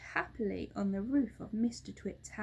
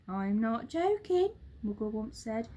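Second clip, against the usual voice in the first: monkey father calming; 6 semitones higher, 4 dB louder than usual. A narrator's reading. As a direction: settle the excited children. Mugglewump insists.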